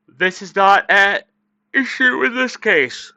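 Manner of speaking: words stretched high and slow by a yawn; reading through a yawn. Real, not acted. Streamer yawns while reading a statement aloud. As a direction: streamer reading a quote to viewers, mid-yawn.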